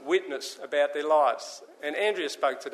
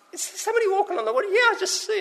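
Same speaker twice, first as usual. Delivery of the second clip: high, fast, astonished chatter; stunned fishermen calling across the boat; excited disciples; faster than usual. Acted. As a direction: squeal it out like you can't believe your eyes. Disciples spotting a figure.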